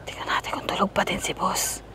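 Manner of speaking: hushed whisper so boss sleeps. Real, not acted. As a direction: whisper so he won't wake. Sneaking to prank sleeping boss.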